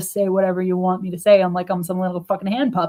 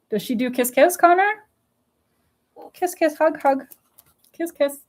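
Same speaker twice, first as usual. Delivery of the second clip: cooing pet talk; 9 semitones higher than usual. Real, not acted. Baby talk to the cat.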